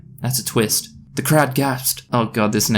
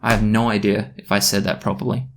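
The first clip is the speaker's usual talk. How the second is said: usual speaking voice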